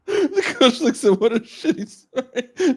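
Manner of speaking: high voice, breaking up with real laughter; talking through laughter. Real, not acted. Host relaying a pun on the shitty story, cracking up. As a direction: read it out while giggling helplessly.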